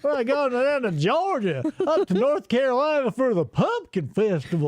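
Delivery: imitating a transplant's fake twang; mock Southern accent. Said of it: "You sound like me".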